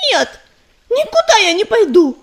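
high, loud angry crying. A narrator's reading. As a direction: shout it choking with rage. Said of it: "закричала Листвичка, захлёбываясь от стыда и гнева".

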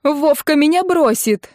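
high, slowing wail of dismay; sudden horror. A narrator's reading. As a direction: realise it with rising panic. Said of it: "вдруг дошло до неё". (с внезапным ужасом)

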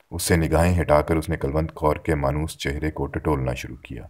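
ordinary delivery

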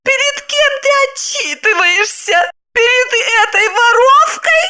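very high, outraged questions; shouting wife. A narrator's reading. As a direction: scold the husband in a shrieking voice. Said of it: "закричала она". (возмущённый крик жены)

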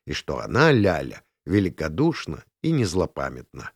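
usual speaking voice

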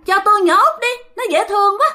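high, loud spirit wheedle; eerie high-pitched request; coveting ghost. Acted. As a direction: beg for the child in a very high ghost voice. Ghost asks for the youngest child.

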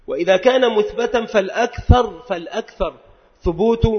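normal talking voice

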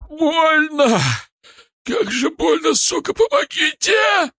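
high, loud cries of pain; agonized wail. A narrator's reading. Injured Шар begs the others for help, agonized wail. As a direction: wail and plead through agony, dragging the last word. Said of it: "нечеловеческий полный агонии вой".

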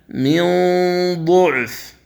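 ينظرون drawn out with the nasal hum held; slow tajweed recitation. Shown as a demonstration. Teacher demonstrating ikhfa before ظ, slow recitation. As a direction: chant ينظرون slowly through the nose. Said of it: "قوله تعالى".

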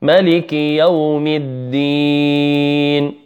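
slow chanted tajweed recitation; measured teaching recitation on a level tone; Quran recitation. Shown as a demonstration. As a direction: recite each verse slowly, drawing out the last vowel. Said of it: "نبدأ بسورة الفاتحة".

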